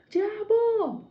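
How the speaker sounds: loud, high-pitched re-enacted scream; shout. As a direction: yell it at him, holding the sound. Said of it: "eu gritei assim".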